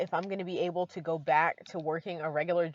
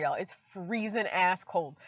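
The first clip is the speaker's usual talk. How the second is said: ordinary talk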